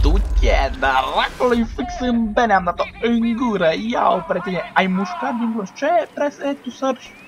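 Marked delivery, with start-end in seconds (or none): 0.0-5.7 loud, drawn-out cursing exclamation; loud gloating. Real, not acted. Player cursing an enemy just hit in the mouth, loud gloating. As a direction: stretch it out loudly over the hit.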